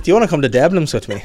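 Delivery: badly faked Irish, on the edge of laughing; bad Irish accent. Friend mimics the boy asking her out, bad Irish accent. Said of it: "That's the worst Irish accent I've ever done".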